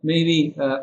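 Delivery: usual speaking voice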